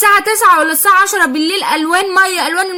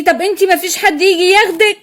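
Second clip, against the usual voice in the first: ordinary talk, nothing marked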